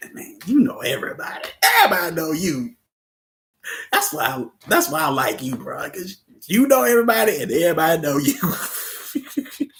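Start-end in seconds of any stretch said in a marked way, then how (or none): 5.6-9.8 loud laughing teasing; teasing a friend while laughing. Real, not acted. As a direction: laugh through the compliment.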